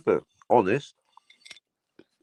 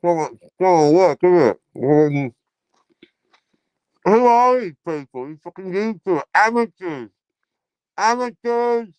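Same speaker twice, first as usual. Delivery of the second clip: higher, indignant put-on voice; mock outraged pundit; 4 semitones higher, 3 dB louder than usual. Mocking YouTube amateurs in a put-on voice. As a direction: sneer at the amateurs in a silly voice.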